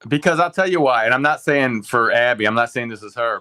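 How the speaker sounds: real loud rebuttal in a heated debate; loud interjection. Real, not acted. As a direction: raise your voice to break in over them. Jumps in to argue back.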